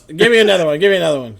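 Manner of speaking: loud, eager demand; eager gnome. Acted. Gnome demanding another rhyme, loud and eager. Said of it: "He goes".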